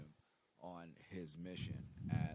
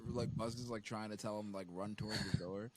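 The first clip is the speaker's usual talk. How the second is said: ordinary talk, nothing marked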